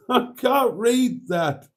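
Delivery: high, slow, laughing through it; laughing at the unreadable comment, words slow and high. Real, not acted. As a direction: giggle while squinting at the text.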